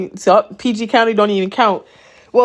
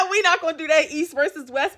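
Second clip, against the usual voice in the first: laughing; 9 semitones higher than usual. Real, not acted. Laughing about East versus West.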